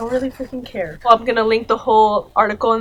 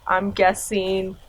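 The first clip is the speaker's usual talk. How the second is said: ordinary delivery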